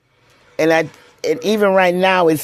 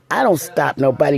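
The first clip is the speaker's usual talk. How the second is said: ordinary talk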